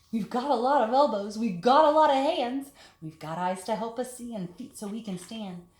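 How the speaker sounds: lively storybook read-aloud; reading to children. Acted. Teacher reading a storybook to her class, lively read-aloud. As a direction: read it like a cheerful picture book for little kids.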